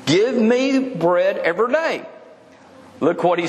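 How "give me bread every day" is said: higher, slow, drawn-out begging; mock greedy demand. People wanting more income, slow mock begging. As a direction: stretch out the greedy request. Mocking greedy crowd again.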